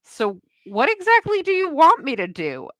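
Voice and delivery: a clerk at the end of her patience; exasperated. Retold or imitated. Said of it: "I'm like".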